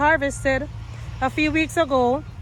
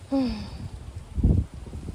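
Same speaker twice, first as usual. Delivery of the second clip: heavy sigh; 6 dB quieter than usual. Real, not acted. After the plant was killed.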